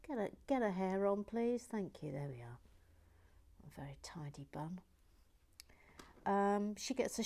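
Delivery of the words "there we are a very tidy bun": whispered aside. Real, not acted. Whispered aside fitting hair.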